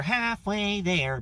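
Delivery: halfway there stretched slow and high, falling; playful slow high drawl of halfway there; drawn out. Retold or imitated. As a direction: sing it out long and slow, way up. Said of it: "you're like".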